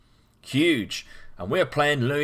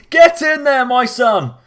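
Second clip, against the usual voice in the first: shouted high and loud in celebration; joyful shout; 11 semitones higher, 10 dB louder, slower than usual. Real, not acted. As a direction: cheer him like a proud dad. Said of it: "we're way too excited".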